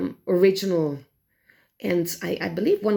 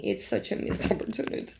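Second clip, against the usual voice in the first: softer, with a rueful laugh breaking in; laughing rueful repeat; 6 dB quieter, faster than usual. Real, not acted. Reviewer laughing to viewers at the missed chance, softly rueful. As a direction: laugh at your own disappointment, quietly.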